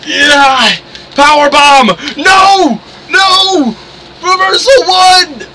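high-pitched wrestling commentary shouts; excited play-by-play. Real, not acted. Player calling the moves of a wrestling game, excited high shouting.

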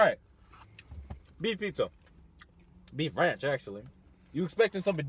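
chewing food while talking; words muffled by food; mouth full. Real, not acted. Seen 1: Left man bites pizza at 1.0, chews; speaker unclear between two.

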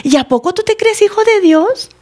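high, mocking accuser's voice; taunting enemy. The enemy accuses the sinner after tempting, taunting. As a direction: jeer with incredulous high-pitched questions. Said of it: "el enemigo viene y nos dice".

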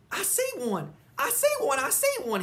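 high, loud, thrilled shouting; excited shout. Acted. Sheldon spotting an egg, thrilled shout. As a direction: yell out the find with joy. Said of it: "he shouted".

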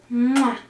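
smacked "mwah" kiss; kiss sound. Acted. Grandma kisses Dani goodnight, kiss sound. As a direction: make a big voiced kiss noise. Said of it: "me da un besito".

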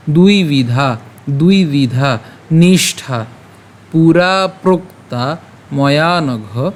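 "দুই বিধা নিষ্ঠা পুরা প্রক্তা ময়া নঘ" slowly, vowels held; pronunciation demo. Shown as a demonstration. Teacher models the verse for learners, slow demo with drawn-out words. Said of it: "কেমন হবে".

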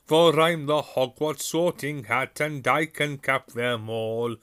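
Sorting Hat's song. A narrator's reading. Sorting Hat recites its song to the new students, booming and proud. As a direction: boom out the rhyme like a proud old talking hat. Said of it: "as the hat finished its song".

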